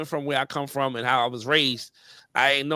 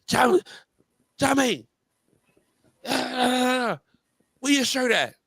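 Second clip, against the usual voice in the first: put-on higher Jamaican-accented scolding call; 7 semitones higher, much slower than usual. Jamaican father scolds his shirtless son on the street, Jamaican accent. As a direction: Jamaican dad spotting the shirtless boy and calling him out. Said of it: "that's a Jamaican accent".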